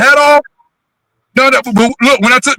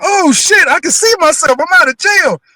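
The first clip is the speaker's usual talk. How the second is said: higher, excited relief; mock joyful outburst; 8 semitones higher than usual. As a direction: blurt it out like you just got free. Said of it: "I was like".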